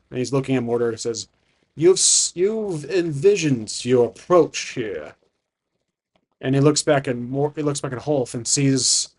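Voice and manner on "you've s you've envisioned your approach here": slow, grand dragon greeting; ancient dragon. Acted. Dragon addressing the adventurer who reached him, slow and grand. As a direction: draw the words out like an imposing dragon.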